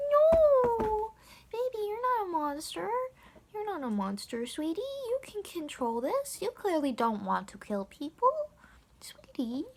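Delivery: put-on high, babying reassurance; mock cooing comfort. Teller consoling the self-declared monster, cooing. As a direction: coo as if soothing a tearful child.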